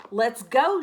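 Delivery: loud, eager call to the pack; eager shout. Acted. Pup Wilf volunteering to lead the pack, eager shout. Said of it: "shouted Wilf".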